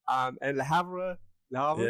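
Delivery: Le Havre stretched slowly; careful French name. Shown as a demonstration. Host practises the French town name, careful. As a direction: say Le Havre deliberately. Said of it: "Is that good?".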